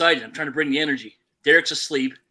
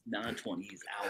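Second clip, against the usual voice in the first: much softer repeat, like an aside; quiet echo; 7 semitones lower, 12 dB quieter than usual. Shown as a demonstration. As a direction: say it again under your breath. Repeats the prediction softly.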